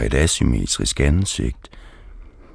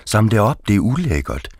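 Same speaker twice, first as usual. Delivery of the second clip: higher, raised scolding demand; mock shouted order; 6 semitones higher than usual. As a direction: bark the order again, pitch up. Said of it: "råber hun". (cyklisten skælder ejeren ud, hævet råb)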